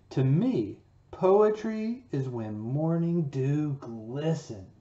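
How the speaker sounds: slow storybook read-aloud; children's teacher reading a poem story. Acted. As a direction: read it to the class, slowly and expressively. Reads the picture book aloud.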